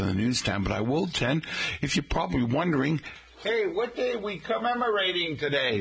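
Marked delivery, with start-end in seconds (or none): 3.4-5.8 raised, higher voice, as if called from off the mike; mock question shouted from off the microphone; mock off-mic shout. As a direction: pretend listener calling from across the room. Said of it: "man, who sounds a lot like me, shouting out from off the microphone".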